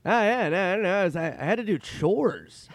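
high, loud, stammering impression; Norm Macdonald. Norm excusing his lateness, loud high stammering impression. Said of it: "he goes".

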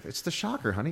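high, sheepish husband voice; mock husband. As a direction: play the husband explaining himself. Husband answering wife.

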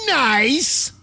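high, sarcastic "Nice"; drawn-out smug "Nice"; mock Trump. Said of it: "That's how he ended that tweet".

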